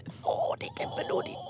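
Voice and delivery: breathless whisper, slowed down. Real, not acted. Cooing over knitting baby nearby. (hvisker henført)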